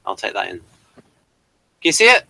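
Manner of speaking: normal talking voice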